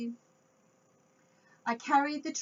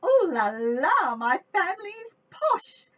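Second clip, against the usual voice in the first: posh ooh la la; 7 semitones higher, 8 dB louder than usual. Acted. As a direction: ham up a snooty French flourish. Posh family, reader hams it.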